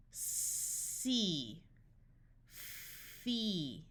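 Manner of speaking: first consonant held long, then gliding into the vowel; drawn-out phonics blending; sounded out. Shown as a demonstration. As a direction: stretch each sound of the word slowly.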